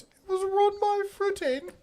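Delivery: put-on very high falsetto old-lady film voice; Mrs. Doubtfire. Husband trying the movie voice for his wife, high falsetto impression. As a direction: attempt the film housekeeper's high-pitched accent. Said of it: "you have to do the voice".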